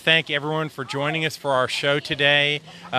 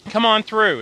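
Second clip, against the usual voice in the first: raised, higher call to people walking by; 10 semitones higher, 6 dB louder than usual. Real, not acted. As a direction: wave them through with a loud call. Calling out to passing voters.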